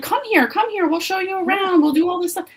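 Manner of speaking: high-pitched gushing welcome, imitated; hammed-up excited hostess pitch; eager invitation. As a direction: coax visitors along in a high, eager voice. Retelling their eager invitation to visitors.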